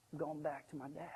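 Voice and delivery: soft, low answer; quiet resolve. Acted. Son tells the servant his plan, quietly resolved. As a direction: answer softly and simply.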